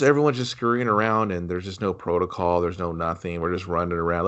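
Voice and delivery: usual speaking voice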